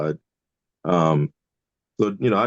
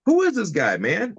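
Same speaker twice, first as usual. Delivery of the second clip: high, curious imitation of a proper-talking lawyer; imitating the lawyer; 10 semitones higher, slower than usual. Lawyer asking the DJ about the comedian, in a high voice.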